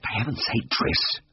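soft, urgent hissed rebuke. A narrator's reading. Vet stops his colleague throwing apples, hissing. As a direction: hiss it under your breath, exasperated. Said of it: "I hissed".

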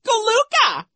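very high, loud mocking squeal; Nero squealing. A narrator's reading. Vice principal parrots the little sister, squealing mockery. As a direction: parrot the baby word in falsetto. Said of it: "he squealed".

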